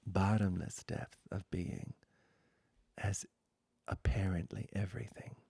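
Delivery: slow, hushed pointing-out litany, partly whispered; a spiritual teacher's slow, half-whispered refrain; contemplative litany. Acted. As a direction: let each word land in stillness. Meditative litany over objects.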